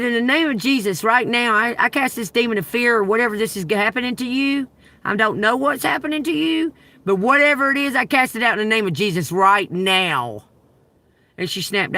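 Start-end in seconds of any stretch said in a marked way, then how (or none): 0.0-10.4 loud, higher, rebuking a demon in Jesus' name; preaching prayer. Retold or imitated. To the collapsed woman, a loud preaching rebuke. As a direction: cast it out like a preacher at full force.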